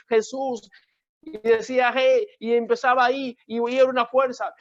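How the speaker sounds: tono alto y apremiante, sostenido; predicación intensa. Real, not acted. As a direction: predica con fervor creciente, voz elevada. (testimonio de púlpito, encendido)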